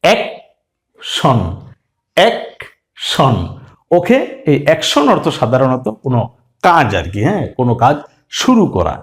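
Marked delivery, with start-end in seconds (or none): 0.0-3.4 এক শন split into two beats; syllables split. Shown as a demonstration. Teacher breaks the word into এক শন. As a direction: say এক then শন, slowly parted. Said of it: "শব্দটা কি হয়ে গেল".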